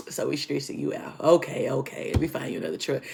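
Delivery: low, soft imitation of a boss giving in; retold dismissive placating. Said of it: "it didn't really sound sarcastic".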